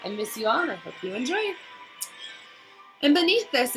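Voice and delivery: usual speaking voice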